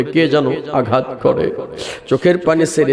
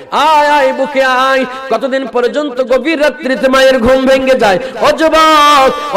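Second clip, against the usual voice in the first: high, loud, chanted appeal; full-force preaching; 11 semitones higher, 5 dB louder than usual. Acted. Preacher pleading with the young men, high and loud. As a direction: cry out to the young men in a sung pitch.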